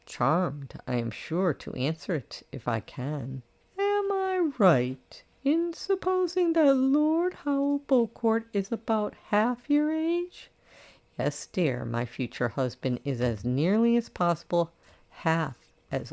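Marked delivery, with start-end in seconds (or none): 3.8-10.5 high, prim matron voice, reproachful; a scandalised elderly friend lecturing her; disapproving old lady. Acted. Old lady probes slyly.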